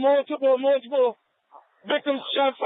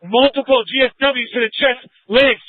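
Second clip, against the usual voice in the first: loud, strained radio shout; urgent shouting; 4 semitones higher, 6 dB louder than usual. Real, not acted. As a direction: shout it over the chaos. Urgent radio call under fire.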